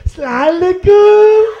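high, loud nasal auntie, last vowel held long; nasalized Pakistani auntie. Acted. Comic imitates a Pakistani auntie, nasal singsong greeting. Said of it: "the nasalized Pakistani auntie".

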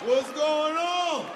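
smug repeated what up, slowing on the end; cocky greeting. Retold or imitated. [name] walking on stage tall, a light cocky greeting. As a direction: strut out greeting everyone. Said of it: "I just walk out".

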